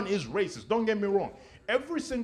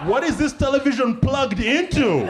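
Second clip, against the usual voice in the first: incredulous quote; 6 semitones higher, 6 dB louder than usual. Retold or imitated. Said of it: "I'm like".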